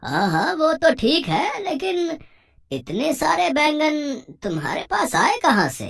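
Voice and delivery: high, drawn-out nosy friend; Santram. Acted. Friend presses Bijju on where so many eggplants came from, high and nosy. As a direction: press the question slowly in a high voice. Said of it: "बिज्जू का दोस्त संतराम".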